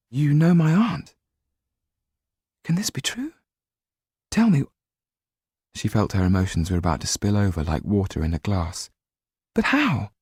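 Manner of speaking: high, eager questions; breathless, hopeful Sido; excited young woman. A narrator's reading. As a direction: press him for the story. Sido's dialogue.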